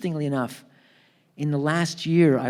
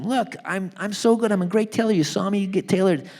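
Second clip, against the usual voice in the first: high, louder pleading argument; arguing; 7 semitones higher, 3 dB louder than usual. Retold or imitated. Harry arguing with the doctor over glasses, arguing. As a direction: argue your case in a raised pitch. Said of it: "Harry starts arguing with him".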